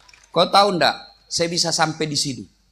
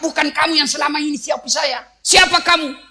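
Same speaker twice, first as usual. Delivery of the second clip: suspicious demand; 8 semitones higher, 4 dB louder than usual. Acted. Blind man confronts Abu Bakar.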